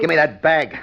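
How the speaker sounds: loud barked order; gunman's harsh demand; angry demand. Acted.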